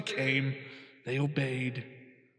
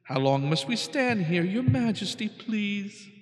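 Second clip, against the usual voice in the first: pleading turtle; 6 semitones higher than usual. Acted. Humble turtle pleads with king.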